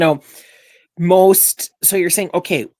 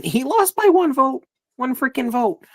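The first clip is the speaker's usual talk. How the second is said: exasperated outburst; 11 semitones higher than usual. Real, not acted. Podcaster venting about the MVP vote to his co-host, exasperated and high. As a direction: pitch jumps much higher in disbelief.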